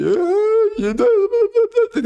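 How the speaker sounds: sing-song mock of the critics, rising very high at the end; squeaky patronizing imitation climbing into falsetto; mocking gaslighter. Mimicking developers who dismiss upset fans, with a high mocking squeak. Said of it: "And then they gaslight you".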